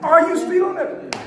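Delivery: loud, high accusing question; accusing officer. Shown as a demonstration. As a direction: throw the hidden accusation at them. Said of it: "Subliminally, what did you hear me say?".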